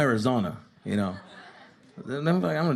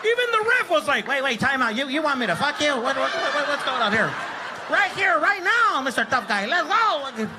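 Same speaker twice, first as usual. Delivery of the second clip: challenging shout; 12 semitones higher than usual. Retold or imitated. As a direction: taunt the ref like a shirtless brawler. Dad challenging ref.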